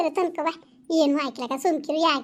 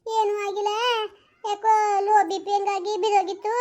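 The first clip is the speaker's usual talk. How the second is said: ordinary talk, nothing marked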